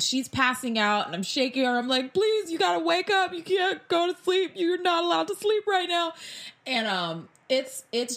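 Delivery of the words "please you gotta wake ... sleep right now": high, desperate plea to stay awake; frantic pleading. Retold or imitated. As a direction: beg her not to fall asleep, frantic. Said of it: "and I'm crying".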